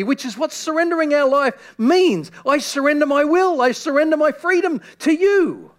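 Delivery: loud, high fervent preaching; preacher pleading as God; preaching at full force. Acted. To the congregation, fervent loud preaching.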